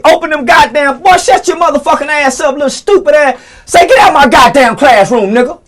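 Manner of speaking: put-on loud, hard teacher telling a kid off; mock teacher scolding. A teacher scolding Charles, loud and rough. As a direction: yell at Charles to sit down. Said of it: "if the teachers can talk like that".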